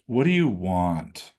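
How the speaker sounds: "What do you want?" dropped low and slow, falling onto "want"; "What do you want?" slow and low, landing on "want"; downshift. Shown as a demonstration. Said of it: "A downshift".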